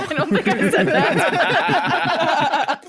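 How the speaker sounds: laughing through the words. Real, not acted. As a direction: giggle the line out. Laughing at own slip.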